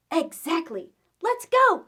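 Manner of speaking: high, loud eager call; rally the group to go; excited rally. Acted. Skelly urges children off trick-or-treating, high and loud.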